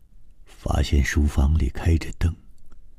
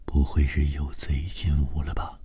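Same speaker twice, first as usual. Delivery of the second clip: whispering; 2 semitones lower, 7 dB quieter than usual. A narrator's reading. Man asking partner about a possible thief, hushed whisper. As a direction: whisper it close to the ear. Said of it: "郑川对高伟耳语道".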